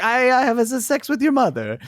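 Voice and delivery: high mock foreign voice stumbling over words; quoted broken English. Co-host retelling a TV character, high mock voice. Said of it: "what was he trying to say when he was like".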